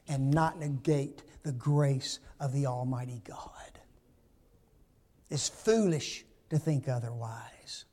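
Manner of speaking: whisper. Real, not acted. Preacher drops to a whisper.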